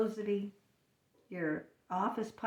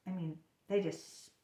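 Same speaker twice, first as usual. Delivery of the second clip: ordinary talk, nothing marked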